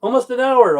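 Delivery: high, loud exclaim. Real, not acted. Calls out about the long wait, loud and high. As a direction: belt out the complaint, high and loud.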